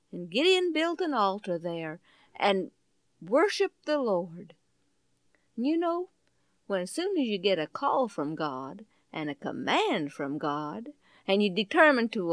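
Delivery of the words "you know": ordinary delivery, nothing marked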